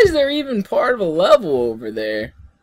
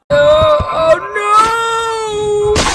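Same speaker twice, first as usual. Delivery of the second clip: high, slow drawn-out wail; long, high cry of dismay; 9 semitones higher, much slower than usual. Real, not acted. Disaster in the game.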